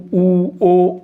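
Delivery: ऊ and ओ drawn out with rounded lips; rounded vowel demo. Shown as a demonstration. Teacher showing class lip rounding on ऊ and ओ. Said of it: "वृत्ताकार स्वर कहते हैं".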